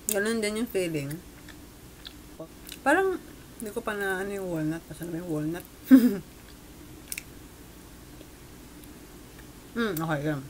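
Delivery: chewing while talking; talking between bites; mouth full. Real, not acted. Seen 2: Bites the cake, then chews with closed lips while talking.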